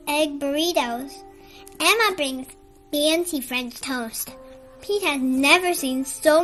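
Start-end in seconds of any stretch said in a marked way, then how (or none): none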